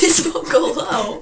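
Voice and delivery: laughing, trying to sneeze low. Real, not acted. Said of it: "I would try to do it really low".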